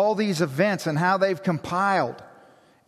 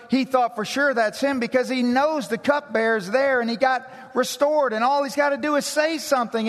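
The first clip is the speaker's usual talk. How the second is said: raised, driving pulpit voice; impassioned preacher, higher and faster; preaching at full force; 8 semitones higher than usual. Acted. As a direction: build it up like a sermon climax. Preaching climax.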